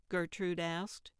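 ordinary delivery

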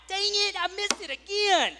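high, loud frustrated outburst at missing the turn; frustrated yelp. Retold or imitated. Driver missing the turn again, a high loud exasperated cry.

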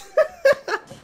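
high-pitched helpless laugh; cracking up. Real, not acted. Fan cracking up on himself, high laughter. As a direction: crack up at your own rant. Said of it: "Got me over cracking up on myself".

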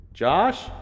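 loud, high questioning call; father's rising, searching yell; yelled from afar. A narrator's reading. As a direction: shout out from the darkness. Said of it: "Dad yelled from the darkness".

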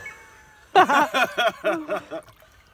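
loud burst of laughter; laughing out loud. Real, not acted. Struggling, laughing at herself.

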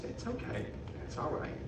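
soft, hushed comfort to a crying friend; soothing. Real, not acted. Ventriloquist softly comforts the upset puppet, hushed and tender. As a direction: hush him gently, almost a whisper.

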